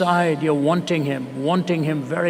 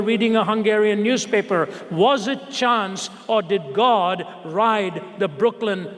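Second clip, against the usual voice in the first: raised, insistent sermon reading that slows at the end; preaching read; 6 semitones higher than usual. Acted. Preacher reads the closing lines to his congregation, raised and insistent. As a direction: build the questions, then draw out the last two lines.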